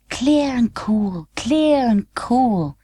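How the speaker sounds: slow, high and hushed; clear and cool. A narrator's reading. Narrator echoes how the queen's voice sounded, slow and hushed. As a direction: let each word fall like drops.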